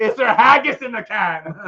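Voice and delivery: high, loud comic Scottish question; Scottish king, close to laughing; Scottish accent. Acted. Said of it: "I got Scottish all of a sudden".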